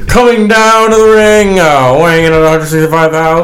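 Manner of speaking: high, loud ring announcer call; boxing ring announcer. Pretend. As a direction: stretch the name like a fight night. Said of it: "Sound, sound like a boxer".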